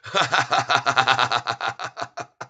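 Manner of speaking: gloating, mocking cackle; real laugh at the losing shorts; long wicked chuckle; mean laugh. Real, not acted. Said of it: "that's a very mean laugh".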